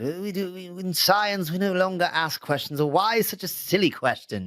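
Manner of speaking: lightly voiced smug scientist, no British accent; mock lofty scientist dismissing why; Richard Dawkins impression. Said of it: "was that a good impression of Richard Dawkins".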